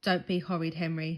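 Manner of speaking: plain reading voice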